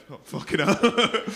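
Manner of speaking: slow, dismayed mock groan of a worried man; imitating worried man. Comic retelling a man's worried reaction, slowed dismayed mock groan. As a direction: drag it out like the bad news just landed. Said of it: "you went".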